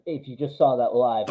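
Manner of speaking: ordinary delivery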